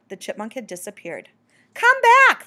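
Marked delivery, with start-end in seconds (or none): 1.7-2.4 high, loud, pleading shout; calling out. A narrator's reading. Bat calls the vanished chipmunk back, high and loud. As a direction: call into the distance, high and strong. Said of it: "the bat called".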